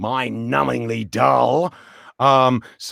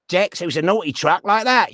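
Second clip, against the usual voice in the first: cheeky Cockney truck, pitched up; Dex; 6 semitones higher than usual. Shown as a demonstration. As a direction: put on a naughty Cockney character voice. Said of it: "he's a bit cheeky".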